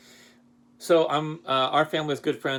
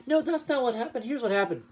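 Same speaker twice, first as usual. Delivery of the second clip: much higher mock protest; teasing teacher mimics his student's denial, much higher; imitating Carly; 11 semitones higher than usual. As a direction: mimic her denying it, high and whiny. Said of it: "gonna make up some story".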